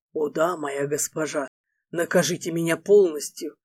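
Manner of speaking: low, soft, playful male lead. Acted. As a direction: answer low, with a held-back smile. Эрго подыгрывает.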